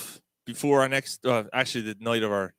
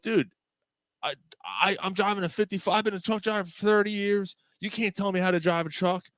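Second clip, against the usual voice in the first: retold indignant protest; 9 semitones higher than usual. Retold phone argument, trucker insulted.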